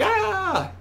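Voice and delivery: a very high squeal of panic; panicked squeal. Retold or imitated. Joining a cast five weeks into rehearsal, high panicked squeal. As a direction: squeal like you're in way over your head. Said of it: "So you're like".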